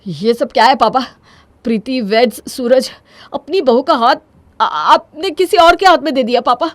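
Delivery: distraught protest, rising to a loud high cry; panicked. Acted. Son confronting his father at the wedding venue, panicked. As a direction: build from shock to fast, loud pleading. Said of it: "घबराहट के मारे विपुल के पैर लड़खड़ा जाते हैं".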